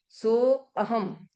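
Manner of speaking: slow, सो and अहम pulled apart; split syllables. Shown as a demonstration. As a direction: say सो अहम slowly, a clear break after सो. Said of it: "एक खंडाकार आया तो सो, अ, हम". (धीरे, "सो" और "अहम" अलग-अलग)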